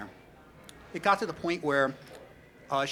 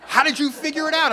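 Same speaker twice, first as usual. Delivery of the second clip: high, loud cartoon bird; bird's smug voice; 13 semitones higher, 11 dB louder than usual. Bird Mary teasing the narrator, high loud cartoon voice. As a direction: squawk it like a smug little bird. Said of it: "the bird just looked at me like".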